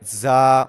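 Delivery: زا said with a whistling hiss and tongue tip hard on the teeth; hard whistled za. Shown as a demonstration. Tajweed teacher demonstrates the whistling زا to students. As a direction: hiss the زا sharply. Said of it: "تھوڑی سی سختی بھی پیدا کرنی ہے".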